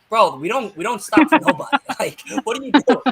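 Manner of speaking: mock indignant, pitch climbing; incredulous wrestler. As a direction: protest like an interrupted performer, half laughing. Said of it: "being like".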